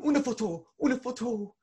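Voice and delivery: high and loud, eager plea for a photo; excited calling out. Retold or imitated. The grateful mom calls to the elf, high excited plea.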